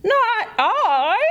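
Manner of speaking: huffy. Acted. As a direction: say it with an offended huff. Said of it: "Owl huffed".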